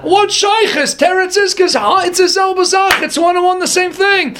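high, impassioned sermon voice carried at full tilt; fervent preaching. Acted. Rabbi stirring his listeners about the kiss, fervent preaching. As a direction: preach it with rising fire.

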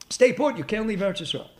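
normal talking voice

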